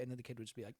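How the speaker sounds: ordinary talk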